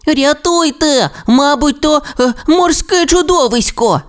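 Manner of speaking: високо й тягуче, від страху; клич на поміч у паніці; наляканий крик. Acted. Расмус бачить тінь у воді. (Расмус у воді кличе на допомогу: переляканий крик)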